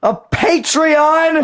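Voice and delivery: high, slow mock shout. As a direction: yell the word out long and high. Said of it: "I heard you".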